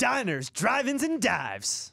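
high, punchy host title call; TV show intro. Acted. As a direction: announce it like a food-show host. Imitating the TV show's title call.

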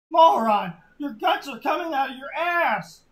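loud, high, crying-out shout; horrified shout. Acted. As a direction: shriek it in horror. Friend horrified at dying friend.

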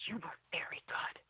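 soft, unvoiced whisper of praise; a fan quietly whispering praise at the sink; whispered compliment. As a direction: hush it like a secret compliment. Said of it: "She's like".

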